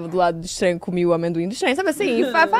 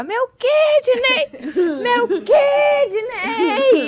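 very high, drawn-out pained shriek; wailing in pain; 14 semitones higher, 6 dB louder, much slower than usual. Retold or imitated. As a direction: scream it like an over-the-top patient in agony. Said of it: "Ela ficava". (berro agudo de dor, imitando)

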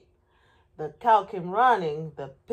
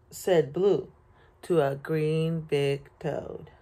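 ordinary delivery

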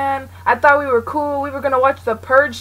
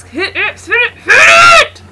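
higher and louder, frantic; urgent shout; 8 semitones higher, 10 dB louder than usual. Real, not acted. Urging himself to hit X, frantic shout.

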